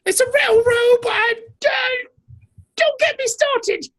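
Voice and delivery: high, hammed-up British accent; defensive English barrister, played big; mock British judge. Continues British judge bit.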